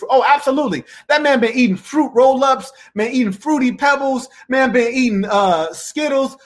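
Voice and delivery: amused teasing jump in pitch; mock excitement. Real, not acted. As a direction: pitch it up like you're roasting him gleefully. Mocking a viewer's fruity remark.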